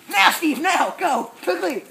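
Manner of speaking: urgent shouting. Acted. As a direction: yell to hurry your partner. Urgent commands during chase.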